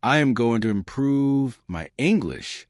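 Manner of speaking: slow, each word fully pronounced; unhurried careful model sentence; slow and clear. Shown as a demonstration. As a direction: say it slowly, focus on clear sounds.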